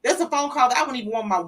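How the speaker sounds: ordinary delivery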